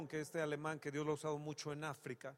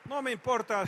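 much louder, higher pulpit fervor; full-force preaching; 6 semitones higher, 12 dB louder than usual. Acted. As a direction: preach it out with joyful fire.

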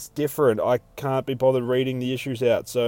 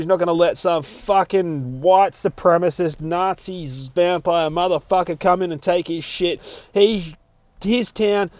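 fired up; 6 semitones higher, 5 dB louder than usual. Real, not acted. Fired-up retelling of redneck resolve.

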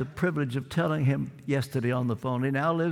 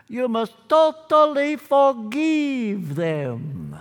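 put-on slow, higher, louder, drawn-out Romanian accent; 5 semitones higher, 5 dB louder, much slower than usual. Preacher mimics his friend's counsel to forgive, Romanian accent. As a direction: mimic an old friend's solemn Romanian accent, stretching each word. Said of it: "in his Romanian accent".

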